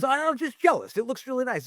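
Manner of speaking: high, sheepish mock dad; a lightly mocking, high dad voice; imitating dad. Dad voiced in retold talk.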